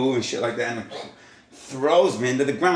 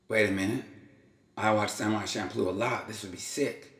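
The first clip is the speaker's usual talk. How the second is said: quiet musing; 7 dB quieter than usual. Retold or imitated. Tempted by the katana.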